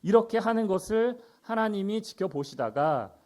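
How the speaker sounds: ordinary delivery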